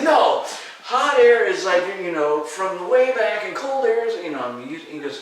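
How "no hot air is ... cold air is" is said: high, loud retold rebuttal; arguing. Student no longer afraid, loudly arguing with teacher. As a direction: insist on the explanation at full volume. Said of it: "I'm arguing logically".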